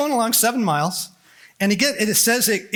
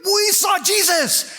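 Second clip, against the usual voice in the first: excited shout; 12 semitones higher than usual. Retold or imitated. Disciples bursting into the upper room, excited shout. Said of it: "they're like".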